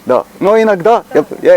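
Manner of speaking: ordinary talk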